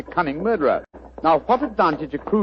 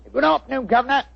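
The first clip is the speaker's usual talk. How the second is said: chirpy cockney delivery pitched up; cockney disguise; 8 semitones higher than usual. Acted. Disguised detective greets the homeowner in cockney. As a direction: play a cheeky London workman. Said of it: "What barbaric jargon do you speak?".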